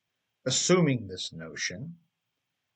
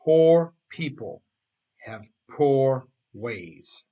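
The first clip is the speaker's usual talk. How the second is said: slow, much louder, every word weighted; emphatic slogan; 8 dB louder, slower than usual. Shown as a demonstration. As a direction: hammer home the motto. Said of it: "I have the emphasis bar".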